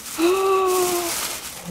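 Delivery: sharp high gasp of delight; excited gasp. Real, not acted. Opening Jean's parcel, a high delighted gasp. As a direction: gasp as the gift is revealed. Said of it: "so, so excited".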